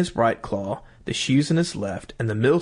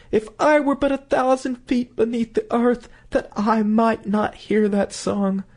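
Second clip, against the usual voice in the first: high, pleading voice that slows at the end; anguished wife; 9 semitones higher than usual. Acted. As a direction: wish yourself under the earth. Wife in anguish hearing song.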